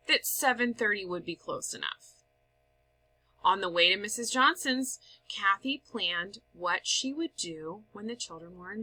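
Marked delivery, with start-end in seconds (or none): none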